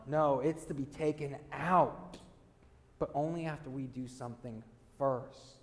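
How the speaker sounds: normal talking voice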